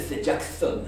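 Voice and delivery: "c'est Jackson" with every s lisped; slushy sh for s. Acted. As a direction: lisp the s sounds like sh. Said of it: "Puisque je zozote".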